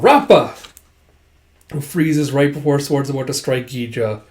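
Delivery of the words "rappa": raised, louder call. Acted. Adviser calls out to the charging fighter, louder call. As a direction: call the name to halt someone. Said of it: "Keisha calls out".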